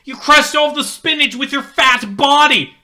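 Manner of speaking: high, loud accusing shout; furious shout. Acted. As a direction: scream the accusation. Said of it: "he shouts".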